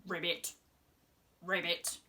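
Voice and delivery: low croaking ribbit; frog noise. Shown as a demonstration. Presenter showing kids a frog croak. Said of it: "Can you make a noise like a frog".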